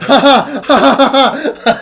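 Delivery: loud, high belly laugh in bursts; overdone innkeeper's guffaw; big hearty cackle. Acted. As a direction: roar with delighted laughter. Halfling roars at the joke.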